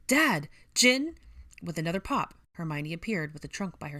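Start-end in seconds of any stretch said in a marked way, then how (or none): 0.1-1.1 high, loud searching calls; urgent cries; calling out. Acted. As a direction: call for missing family. Calling for missing family.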